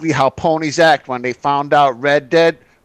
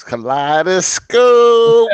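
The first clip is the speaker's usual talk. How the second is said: hype announcement; 6 semitones higher, 3 dB louder, much slower than usual. Acted. Announcing co-host's name.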